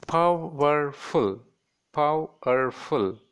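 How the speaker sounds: "powerful" slowly split as pow-er-ful; three distinct beats on "powerful"; three separate syllables. Shown as a demonstration. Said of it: "that is three syllables".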